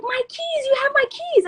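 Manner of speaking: high, insistent imitated plea; frantic pleading. Storyteller voicing friend who wants her keys, frantic pleading. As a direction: imitate a friend urgently calling down the street.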